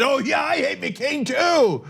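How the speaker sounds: a hammed-up pundit agreeing lazily; mock Jeanine Pirro. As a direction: sneer it out slowly, lightly mocking. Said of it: "Jeanine Pirro came out and said".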